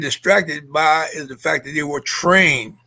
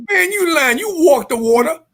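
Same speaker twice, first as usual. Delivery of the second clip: mocking friend insisting; 9 semitones higher, 3 dB louder than usual. Mock friend insisting, high.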